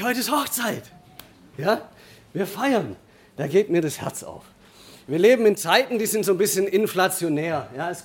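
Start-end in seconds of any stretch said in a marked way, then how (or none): 0.0-3.0 high, bright and cheerful; happy. Retold or imitated. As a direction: say it beaming with joy. Father's own joyful reply, retold.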